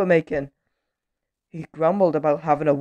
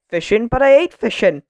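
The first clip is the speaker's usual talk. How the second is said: raised, higher-pitched objection; indignant protest; 6 semitones higher, 6 dB louder than usual. A narrator's reading. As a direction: protest in grumpy disbelief. Grumbler protests the suggestion.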